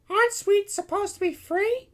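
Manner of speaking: very high, loud princess voice; puzzled princess. A narrator's reading. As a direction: ask as a naive little princess. Princess questions her friend.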